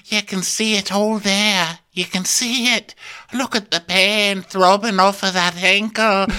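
high, drawn-out dramatic fretting; exaggerated high impression of a worried crewmate; Tosh. Tosh fretting over Kyle's throbbing ankle, high mock concern. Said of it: "Tosh, Tosh goes".

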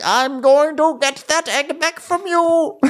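put-on high, drawn-out old man voice; frail old guy. Podcaster mocking an old knight, frail old guy. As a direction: creak out a slow, high mock old-timer. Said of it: "this just old frail guy be like".

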